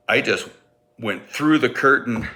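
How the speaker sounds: ordinary talk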